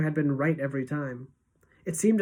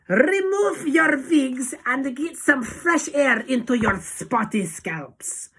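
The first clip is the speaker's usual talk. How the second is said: Grand High Witch; 9 semitones higher, 4 dB louder, slower than usual. A narrator's reading. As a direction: screech it, rolling every R, W as V. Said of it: "she shouted".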